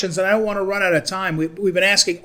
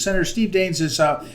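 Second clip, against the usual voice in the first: normal talking voice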